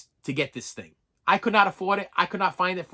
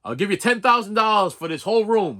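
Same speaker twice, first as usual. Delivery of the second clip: louder, higher mock buyer's offer; troll-like buyer, pushed up and louder; little troll; 4 semitones higher, 5 dB louder than usual. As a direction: imitate a troll of a dealer making his pitch. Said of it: "This little troll would come".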